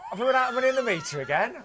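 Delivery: laughing high and fast through the question; bubbly laughing joke. Real, not acted. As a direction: giggle the whole line out. Laughing jokey banter about meter.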